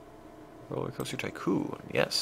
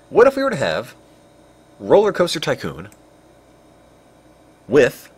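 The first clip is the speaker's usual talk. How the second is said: excited pitch; 6 semitones higher, 11 dB louder than usual. Real, not acted. Pitching dumb idea to chat.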